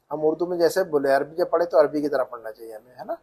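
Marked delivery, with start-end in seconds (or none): none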